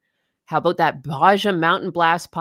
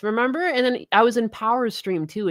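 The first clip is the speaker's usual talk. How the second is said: ordinary talk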